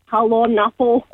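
playful put-on Scottish accent. Shown as a demonstration. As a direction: mimic a Scottish woman's pet-name greeting. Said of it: "I do it in the Scottish accent too".

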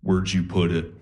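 low, eerie sleepwalker murmur; lightly voiced creepy half-asleep girlfriend; creepy low sleep-talk voice. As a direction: drop low like a possessed sleeper. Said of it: "she just turns to me and goes".

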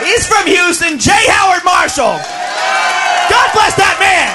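loud comic rant. Acted. Comic ranting to the crowd, loud. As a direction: shout it at the crowd in mock outrage.

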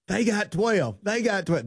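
high excited yelling; excited shout. Retold or imitated. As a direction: jump up and down yelling it. John jumping up and down.

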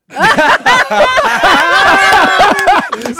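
big high cackle; host roaring with laughter at the singing; loud laugh. Real, not acted. Host cracks up loudly.

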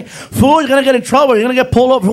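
loud, high mock scolding; imitated yelling friend. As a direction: nag the driver at a yell. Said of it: "Felipe is yelling at me".

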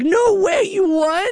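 high, disbelieving exclamation; can't believe it; surprised mom. Retold or imitated. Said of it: "And she's like".

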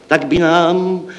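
high, sustained proclamation. Acted. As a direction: lift the pitch proudly and hold it. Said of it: "zvýšil jsem hrdě hlas".

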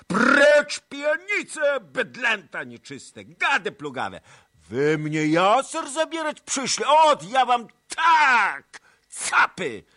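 very high, loud raging yell; furious roar. A narrator's reading. Tuchaj Bej driving off the Cossacks, furious roar. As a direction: tear into them like a tiger.

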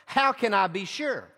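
ordinary talk

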